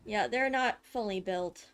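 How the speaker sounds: ordinary talk, nothing marked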